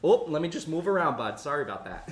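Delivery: Minnesota apology starting high and loud, settling down; Minnesota accent. Pretend. Minnesotan apologising while moving round someone, mock Minnesota accent. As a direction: open on a big Minnesota 'ope'. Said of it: "I love that accent".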